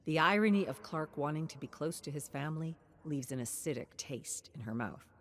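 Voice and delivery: ordinary delivery, nothing marked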